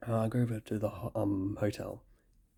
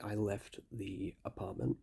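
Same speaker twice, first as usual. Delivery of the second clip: ordinary talk, nothing marked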